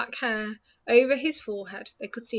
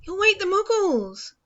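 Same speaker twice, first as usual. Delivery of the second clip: stern schoolmistress voice; 10 semitones higher, 6 dB louder than usual. Acted. McGonagall scolding Hagrid.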